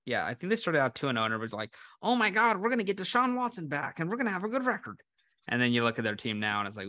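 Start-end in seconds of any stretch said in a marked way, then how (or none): 2.0-5.0 high-pitched hammed-up Cleveland fan; excited mock-Cleveland accent, pitched up. Browns fans hoping for Watson's return, Cleveland accent. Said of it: "is that a Cleveland accent?".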